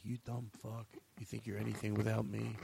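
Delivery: very quiet, menacing put-down; underplay a bully berating his victim under your breath; mock bullying. Host playing his abuser in a bit, hushed mock menace. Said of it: "And he's like".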